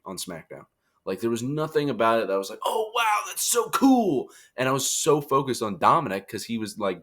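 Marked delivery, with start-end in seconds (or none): 2.6-4.3 high, drawn-out fake wonder; mock amazement. Viewer reacting to an unremarkable match, mock amazed. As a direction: gush slowly in an exaggerated high voice.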